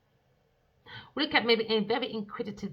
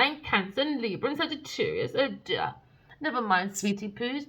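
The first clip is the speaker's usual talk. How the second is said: normal talking voice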